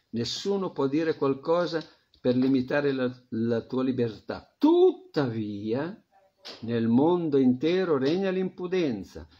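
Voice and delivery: usual speaking voice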